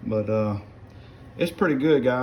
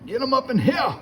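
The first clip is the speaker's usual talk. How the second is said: very high, hollered hunting call; rabbit-dog call; 14 semitones higher than usual. Retold or imitated. Hunter calling rabbit dogs back in the day, high holler. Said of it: "calling rabbit dogs back in the day".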